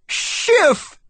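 high, delighted call; high-pitched happy exclamation; excited cry. A narrator's reading. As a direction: cry out the name in glad surprise. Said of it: "I cried".

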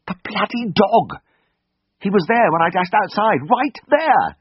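high, frantic and slow; panicked outburst, voice climbing. Acted. Panicked about lost dog.

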